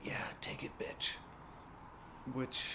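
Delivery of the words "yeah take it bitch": breathy whispered porn line. Retold or imitated. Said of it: "I hear".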